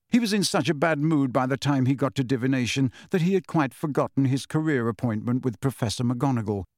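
ordinary delivery, nothing marked

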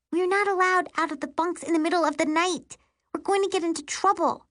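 high-pitched, objecting sister voice; worried sister. Acted. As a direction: protest in a high voice, fearing trouble.

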